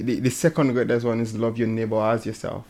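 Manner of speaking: normal talking voice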